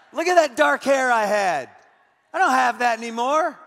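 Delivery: loud, laughing through the words; laughing at himself. Real, not acted. Pastor laughing at his own old photo, loud and amused.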